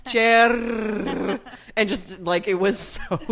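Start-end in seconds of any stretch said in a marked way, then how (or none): 0.1-1.4 "Chair" with a long, heavily rolled R. Shown as a demonstration. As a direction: roll the R in "Chair" hard, Indian-accent style. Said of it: "super rolled the R on chair".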